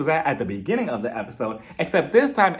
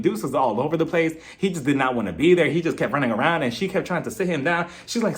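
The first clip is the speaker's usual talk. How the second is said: normal talking voice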